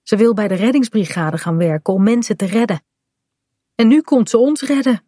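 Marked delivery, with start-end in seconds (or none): 3.7-5.0 higher-pitched, mild relief; relieved. A narrator's reading. Kaat sees Bink's mother coming to save them, relieved. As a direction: let relief lift the pitch. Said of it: "zegt Kaat opgelucht".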